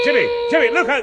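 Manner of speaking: sudden cry of alarm; warning shout. Acted. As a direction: yell a warning to the boy. Warning as Alfie falls.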